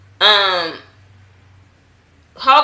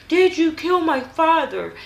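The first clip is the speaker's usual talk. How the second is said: desperate demand; 9 semitones higher, slower than usual. Retold or imitated. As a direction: press the question harder, pleading. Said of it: "'Cause she was like".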